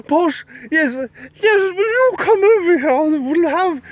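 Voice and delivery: high, hammed-up posh British old lady. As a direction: Julia Child-like high British warble. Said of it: "posh old lady British accents".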